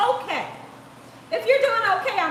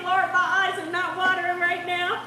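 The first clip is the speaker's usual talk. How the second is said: normal talking voice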